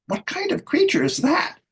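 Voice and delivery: high squawky voice, way up; high mocking fairy squawk. A narrator's reading. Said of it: "someone squawked".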